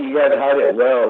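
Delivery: ordinary talk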